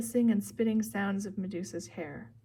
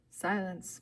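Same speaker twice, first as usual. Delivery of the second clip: usual speaking voice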